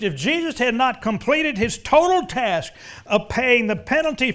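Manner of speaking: loud, high impassioned preaching; full-force preaching. Real, not acted. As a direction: preach it hard, pushing the point home.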